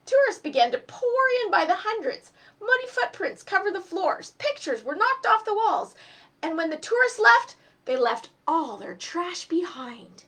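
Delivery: put-on lively read-aloud voice for children; story narration. A narrator's reading. Teacher reading a storybook to children, lively. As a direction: tell the tale to a class, animated. Reads a picture book aloud.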